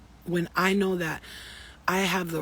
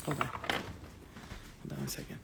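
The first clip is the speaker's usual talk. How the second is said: breathy whisper, pulled way down; whispered aside; 6 semitones lower, 14 dB quieter, slower than usual. Real, not acted. Pausing her own reading, she whispers an aside. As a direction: drop to a whisper to pause.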